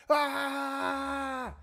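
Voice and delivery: long, high, held scream; hammed-up scream of pure frustration; enraged scream. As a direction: scream over the other person. Acted screaming quarrel continues.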